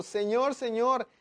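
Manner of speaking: high, loud, slow trembling cry to the Lord; Paul trembling and afraid, crying out to the Lord; trembling, fearful plea. Acted. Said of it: "Él temblando y temoroso dijo".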